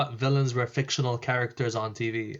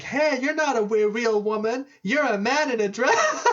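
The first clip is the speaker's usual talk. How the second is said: higher mocking jeer, half laughing; taunting boy; 8 semitones higher than usual. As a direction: tease her like a smug boy. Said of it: "this boy would come up to her and be like".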